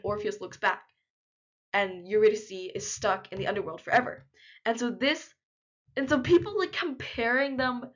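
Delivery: normal talking voice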